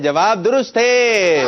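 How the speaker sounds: high, drawn-out proclamation; triumphant announcement. Real, not acted. Quiz host declaring the answer right, triumphant announcement. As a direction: call it out slowly, stretching the last word.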